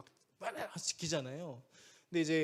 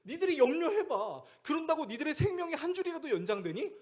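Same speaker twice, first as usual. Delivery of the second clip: pointed challenge; 11 semitones higher, 4 dB louder than usual. Acted. Voicing Jesus challenging worriers.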